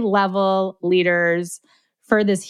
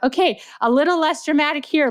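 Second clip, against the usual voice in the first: higher-pitched director's note, lightly acted; voiceover director; 8 semitones higher than usual. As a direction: lightly imitate a director coaching talent. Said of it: "directing voiceover artists".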